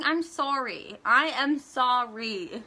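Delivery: exasperated, drawn-out mock apology; sarcastic apology. Sarcastic apology to those mocking her.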